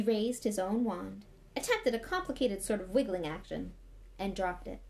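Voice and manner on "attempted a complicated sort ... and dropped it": plain reading voice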